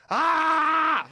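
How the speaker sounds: loud, high cry of alarm; alarmed shout. Retold or imitated. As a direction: yell as if the rope just snapped. Picturing the stunt going wrong.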